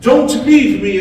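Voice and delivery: loud, high, slow begging cry; screaming plea. Retold or imitated. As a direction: beg them not to go, full voice. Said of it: "I was screaming and begging them".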